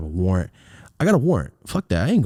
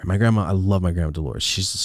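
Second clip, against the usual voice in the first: ordinary delivery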